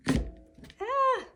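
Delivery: sharp high yelp of surprise; a quick startled cry. Real, not acted. Painter yelping when the brush slips, startled. Sudden slip while painting.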